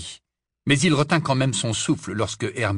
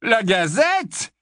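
high, slow scornful scoff; 11 semitones higher, 4 dB louder, much slower than usual. A narrator's reading. Ted scoffing at Dirk's newspaper, scornful. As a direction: sneer at the newspaper's name. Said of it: "avec mépris".

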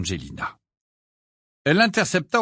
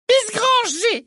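very high, sharp cut-off; cutting Umbridge; 6 dB louder, much slower than usual. A narrator's reading. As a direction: shut her down. Ombrage voiced. (voix tranchante et aiguë)

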